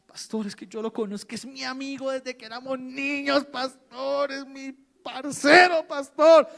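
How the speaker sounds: mock a stubborn churchgoer begging the pastor; imitated congregant pleading, rising to a cry; whiny pleading member. Congregant begs pastor to keep friend.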